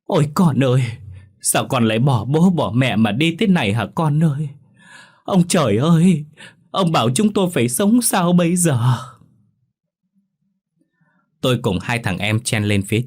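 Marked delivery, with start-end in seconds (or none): 0.0-4.5 higher, louder mourning wail; keen for a lost child; grieving parents wailing at the ditch; wailing lament. A narrator's reading. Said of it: "tiếng khóc não nề ỉ ôi". 5.2-9.0 high, loud anguished wail; wailing lament. A narrator's reading. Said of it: "tiếng khóc não nề ỉ ôi".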